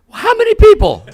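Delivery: very high, loud panicked squawk; mock panic. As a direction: shriek it in hammed-up panic. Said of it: "My brain went into panic".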